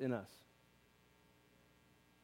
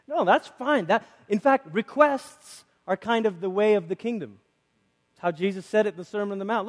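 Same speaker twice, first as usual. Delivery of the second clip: normal talking voice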